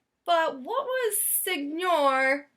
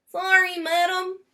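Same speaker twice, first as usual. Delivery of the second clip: higher, louder polite salesman's voice; 7 semitones higher, 4 dB louder than usual. A narrator's reading. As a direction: answer the lady courteously.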